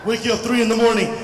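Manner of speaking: ordinary delivery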